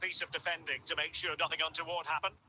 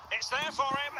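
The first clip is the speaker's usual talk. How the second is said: higher-pitched, urgent chance call; excited commentary; 7 semitones higher, 3 dB louder than usual. Real, not acted. Commentator spotting a scoring chance, excited. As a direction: voice leaps up as the chance opens.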